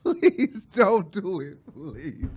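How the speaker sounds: hammed-up, drawn-out plea; pleading. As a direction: drag out each please, imploring God. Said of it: "I was pleading. I said".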